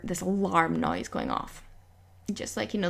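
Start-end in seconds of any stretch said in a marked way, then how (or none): none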